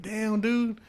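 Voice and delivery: high, stunned reaction; mock dismay. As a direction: act floored. Retold reaction to roommate.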